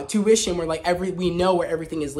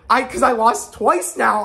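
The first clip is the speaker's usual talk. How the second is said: mock Vader sulk; 5 semitones higher, 6 dB louder, slower than usual. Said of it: "funny as hell if he said".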